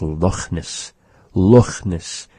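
"Loch" with a rasped back-of-mouth CH; Scottish raspy CH. Shown as a demonstration. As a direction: say "Loch Ness" as a Scotsman does. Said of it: "He pronounces the C H".

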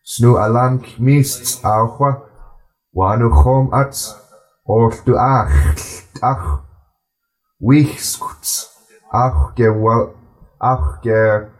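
unhurried, even recitation of the text; slow reading aloud. Shown as a demonstration.

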